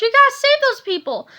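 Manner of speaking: put-on loud high urgent kid; urgent cry. A narrator's reading. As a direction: beg him to save them. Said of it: "cried George".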